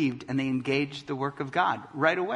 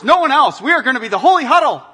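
mock insular Christians; 9 semitones higher, 13 dB louder, faster than usual. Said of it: "so many Christians wanna".

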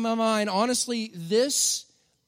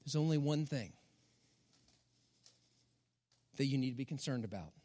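quiet, lowered voice with compassion; compassionate Jesus; 8 semitones lower, 9 dB quieter than usual. Retold or imitated. Jesus gently answering Martha, soft and compassionate. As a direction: say it gently, like a kind correction. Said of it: "with compassion says this".

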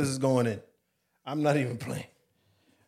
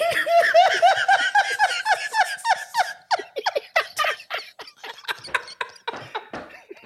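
shrieking laughter, cracking up; wild high laughter; 4 dB louder than usual. Real, not acted. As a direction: howl with laughter, out of control. Long laughing fit.

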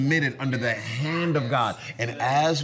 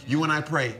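normal talking voice

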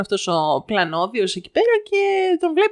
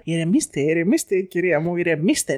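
low, measured calming voice; placating man, acted; 5 semitones lower than usual. Vendor calming an irate lady, low placating voice. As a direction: talk her down, imitating the vendor. Vendor soothes the raging mother.

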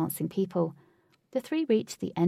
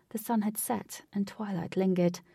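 ordinary delivery

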